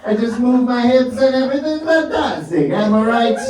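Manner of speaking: high, hammed-up Italian accent; sing-song mock-Italian accent; pretend Italian. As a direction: play a carefree Italian, pitched right up. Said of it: "I have to pretend to be an Italian".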